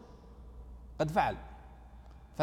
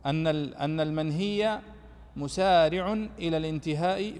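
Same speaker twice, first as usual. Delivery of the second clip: slow dictation of a correction; slower than usual. Shown as a demonstration. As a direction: dictate المنهي مسارع slowly so they can correct the book. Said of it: "عدلوها يا شباب في ال-، في الكتاب".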